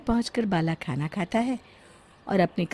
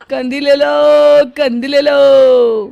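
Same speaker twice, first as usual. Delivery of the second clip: loud, high, drawn-out vendor cry; street hawker call; 8 semitones higher, 14 dB louder, slower than usual. Acted. Comb seller calling to passersby, loud slow hawker cry. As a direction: sing out your wares to the street.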